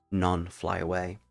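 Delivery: plain reading voice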